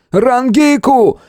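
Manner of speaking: high, drawn-out desperate call; straining yell. A narrator's reading. Hero keeps yelling for the healer, straining. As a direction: stretch the name into a high shout. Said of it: "Голос сорвался от напряжения".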